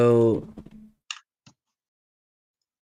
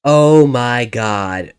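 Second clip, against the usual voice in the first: shocked shout; 2 semitones higher, 14 dB louder than usual. Real, not acted. Loud shocked reaction.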